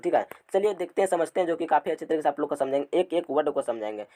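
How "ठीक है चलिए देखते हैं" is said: normal talking voice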